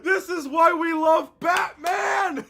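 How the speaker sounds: loud, drawn-out fan cheer; excited cheer. Real, not acted. Fan reacting to Batman, delighted shout. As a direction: cry it out high and slow, elated.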